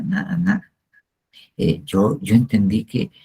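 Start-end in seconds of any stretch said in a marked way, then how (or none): 0.0-0.7 nada, nada lifted much higher in an aching cry. Real, not acted. Preacher to his flock, nothing mattered, crying out nada, nada. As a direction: throw nada, nada up high, almost crying out.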